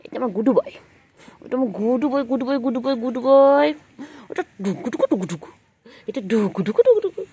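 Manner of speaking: loud, bouncy pet-talk chant; cooing praise. Real, not acted. (জোরে আদুরে ছড়াকাটা)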